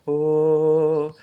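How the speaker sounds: long loud high "Ô" like a train whistle; train whistle call. Shown as a demonstration. Teacher imitating the train's whistle while reciting, loud and high. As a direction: blow it out like a locomotive whistle. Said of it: "Ele começa assim".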